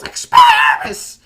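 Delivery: extremely high, loud, drawn-out yell; Harry Potter. Retold or imitated. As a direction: shriek the spell in a squeaky kid voice. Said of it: "then suddenly he just yells".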